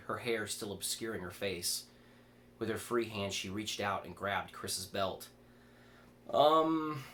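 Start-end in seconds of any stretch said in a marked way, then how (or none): 6.3-7.0 high, loud startled um. Acted. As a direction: blurt a startled um. Chris startled by grab.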